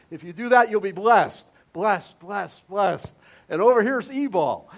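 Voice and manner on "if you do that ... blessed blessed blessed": loud, ringing proclamation of blessing; proclaiming blessing. Retold or imitated. Pastor voicing God promising blessing to Israel, loud and proclaiming. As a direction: call it out like God's promise to the people. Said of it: "it was like God, s-, uh, through Moses".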